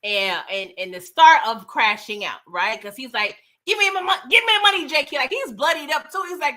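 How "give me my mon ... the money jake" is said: higher, pleading and demanding at once; desperate demand. Retold or imitated. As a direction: beg for the money, pushed higher. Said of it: "this when he becomes desperate".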